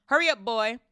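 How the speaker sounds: high, loud impatient shout. A narrator's reading. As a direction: yell it to someone in another room. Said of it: "shouted Uncle Vernon from the kitchen".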